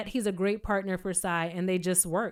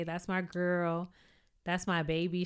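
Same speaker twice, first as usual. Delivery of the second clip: tender; 3 semitones lower, 6 dB quieter than usual. Real, not acted. Speaking tenderly about her own mother.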